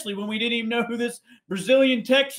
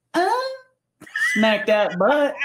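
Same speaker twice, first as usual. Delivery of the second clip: imitating Christy James; 5 semitones higher, 5 dB louder, slower than usual. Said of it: "she would just be like".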